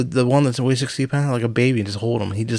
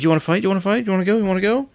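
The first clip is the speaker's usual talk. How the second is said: higher, yappy little-dog challenge; scrappy Chihuahua; 8 semitones higher, faster than usual. Retold or imitated. A Chihuahua picking a fight, voiced high and yappy. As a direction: voice a tiny dog itching to fight, pitched up. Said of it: "Chihuahuas like".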